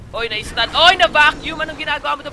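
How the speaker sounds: high, loud rapid play-by-play; excited yelling. Real, not acted. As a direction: scream the fight as it happens. Teamfight peaks, caster yells.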